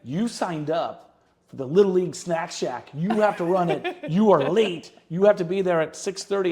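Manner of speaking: loud, firm orders from a wife with hand on hip; wife meaning business. Retold or imitated. Wife telling husband he's late for Snack Shack, loud and firm. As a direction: bark out the schedule like a stern wife. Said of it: "meaning business".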